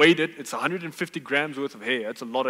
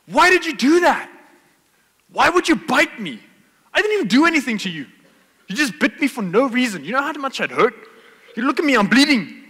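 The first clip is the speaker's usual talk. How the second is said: much higher and louder, yelling in outrage; furious yelling; 10 semitones higher, 9 dB louder than usual. Retold or imitated. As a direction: scream at the bush like a bitten hiker. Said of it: "he starts yelling at the bush".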